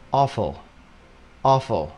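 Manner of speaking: "awful" as "AW-fl", no full u; dropped U. Shown as a demonstration. Teacher shows the native-style reading, dropped U. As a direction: say "awful" with only a very light schwa before the l. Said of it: "nó bỏ chữ U luôn".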